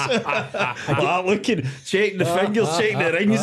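laughing. Real, not acted. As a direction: chuckle your way through the words, slowed at the start. Laughing while retelling the flight.

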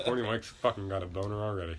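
ordinary talk

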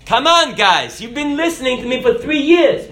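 mock-pleading Jesus asking the disciples to recognize him. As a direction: play a whiny Jesus for laughs. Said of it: "He didn't say".

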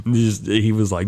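ordinary talk

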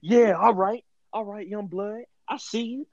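put-on hyped-up older guy cheering a kid; an older man hyping a young player after a Pop Warner game.

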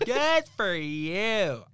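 very slow, high, exaggerated praise; hammed-up, drawn-out congratulations; mock congratulations. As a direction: stretch every word in a high mock-gush. Tops co-host's exaggerated echo.

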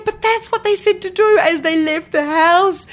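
high-pitched mock outrage at being set up; indignant protest. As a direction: protest that you only followed instructions. Indignant protest over gourd soup.

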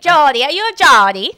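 playful excited question; excited teasing. Real, not acted. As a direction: tease him with a bright loud question. Teasing the co-host.

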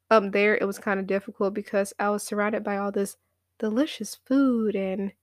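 normal talking voice

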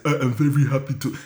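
a light, mocking fake African accent. Mocking American comics' fake African accent, lightly done. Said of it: "try and do, like, an African accent".

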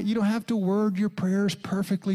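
ordinary delivery

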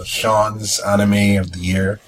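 ordinary talk

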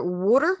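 water as wooter, r sounded; local pronunciation. Retold or imitated. Mocking local speech, water as wooter. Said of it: "But they say here".

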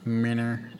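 flat robotic Minner. Acted. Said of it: "Why'd you say it like a robot?".

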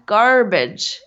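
mock American accent, one word. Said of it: "I've got such a good American accent".

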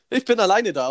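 "Ich bin alleine da" blurted out loud and high; hammed-up loud announcement of being alone; mock loud declaration. Friend mimics him at the club, mock loud. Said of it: "und du so".

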